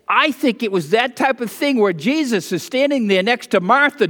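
normal talking voice

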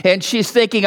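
ordinary delivery

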